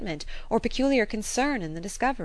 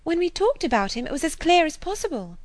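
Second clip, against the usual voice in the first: a lightly voiced eager young woman, a bit higher; excited Harriet; 9 semitones higher, 3 dB louder than usual. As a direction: gush the news breathlessly like a gossiping girl.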